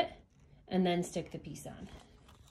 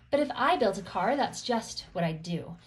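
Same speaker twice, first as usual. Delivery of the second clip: "But if I built a car" read brisk, "that's just what I'd do" landing down; tossed-off rhyming refrain, full voice; storybook refrain; 5 dB louder than usual. Acted. Reading the book's refrain the first time, brisk and bright.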